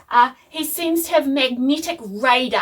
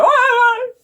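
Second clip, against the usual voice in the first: high, loud yelp; mock pained yell; 13 semitones higher, 8 dB louder than usual. Imitating Dad standing on a pin, mock pained yell. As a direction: imitate a sudden howl of pain.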